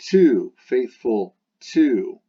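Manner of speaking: "to" dicho lento, alto y fuerte; stress on TO. Shown as a demonstration. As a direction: remarca mucho la palabra "to". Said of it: "Gotta be faithful to something".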